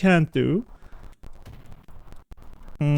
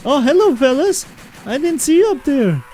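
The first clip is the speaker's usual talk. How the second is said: mock friendly greeting; 11 semitones higher, 4 dB louder than usual. Mock greeting surprised enemies.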